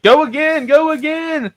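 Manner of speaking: put-on high, loud, eager child voice; imitated little kid. Dad voicing daughter after the crash, excited high kid voice.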